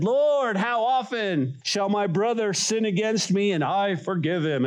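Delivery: high-pitched, earnest question; eager Peter. Acted. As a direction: call it out high like a keen disciple. Acting Peter's eager question to Jesus.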